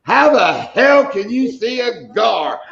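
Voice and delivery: high, drawn-out indignant wife; mock outrage, stretching out each word; retold indignant outburst. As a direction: imitate a wife's incredulous holler, slowly. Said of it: "my woman whoops around and goes".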